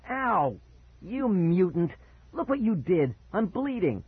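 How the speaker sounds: high, indignant yelping; pained outrage. Acted. Bitten brother accuses sibling, high and outraged.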